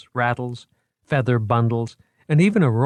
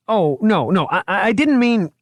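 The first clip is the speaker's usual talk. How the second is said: high, louder, flustered stammer; a horrified curator stammering an apology, high and loud; 12 semitones higher, 6 dB louder than usual. A narrator's reading. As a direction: trip over the words in panic. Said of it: "he stammered".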